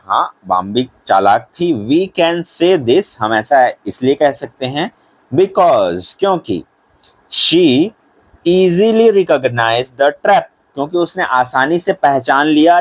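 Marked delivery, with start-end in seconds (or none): 1.9-10.4 English answer read word by word; very slow and clear; teacher dictating for notebooks; slow dictation. Shown as a demonstration.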